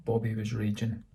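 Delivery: ordinary delivery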